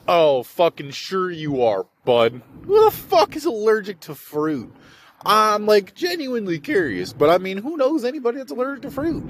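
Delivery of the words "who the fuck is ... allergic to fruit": high, disbelieving outcry; can't believe it; much higher exasperated ranting; incredulous. Real, not acted.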